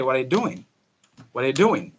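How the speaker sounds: "What are" run together, the T in "What" dropped; silent T. Shown as a demonstration. Teacher demonstrates linking, "What" with its T silenced. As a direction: say "What are you" as a quick "wha-ya", no crisp T. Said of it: "you are going to silence that T".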